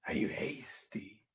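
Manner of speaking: hushed, secret whisper of the question. Pretend. Host whispering a quiz question to children, hushed.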